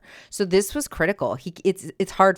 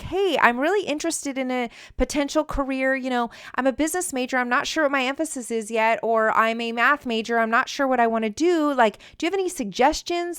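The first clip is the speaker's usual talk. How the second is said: higher, keen student voice; eager student; 7 semitones higher than usual. Shown as a demonstration. Parent models what a student tells a professor, higher and eager. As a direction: sound hopeful and interested.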